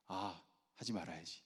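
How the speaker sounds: low, soft self-talk; child's inner resolve. Retold or imitated. Child deciding to keep silent, hushed inner resolve. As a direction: quietly voice a child's private decision.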